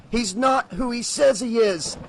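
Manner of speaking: Breaker's urgent warning. Acted. Breaker warns the sheriff against the salesman, urgently. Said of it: "Breaker said".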